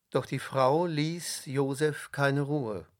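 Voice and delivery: ordinary delivery, nothing marked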